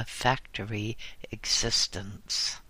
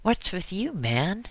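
higher-pitched, gently puzzled question; mild inquiry; 8 semitones higher than usual. A narrator's reading. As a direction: ask it lightly, voice lifting. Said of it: "mildly inquired".

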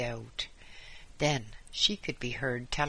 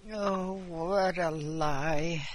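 put-on old man voice; 4 semitones higher, much slower than usual. A narrator's reading. Old man denies the charge.

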